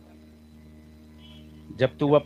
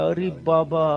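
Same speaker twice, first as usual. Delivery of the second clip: put-on Bengali accent in Hindi. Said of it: "Bengali दादा".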